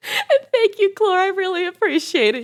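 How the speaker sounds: high, loud, squealy thanks; excited thanks. Real, not acted. Streamer thanking viewer Clore, high and squealy. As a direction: beam your gratitude.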